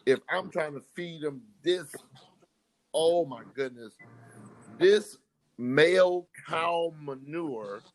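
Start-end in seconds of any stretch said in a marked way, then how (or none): 4.8-7.8 slow, deliberate euphemism; mock censored phrasing. Real, not acted. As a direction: drag out the polite euphemism. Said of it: "I'ma say it like that".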